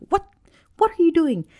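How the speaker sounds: high-pitched, incredulous scolding; exasperated mock yell. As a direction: imitate a parent snapping at a child. Acts out usual reaction to child.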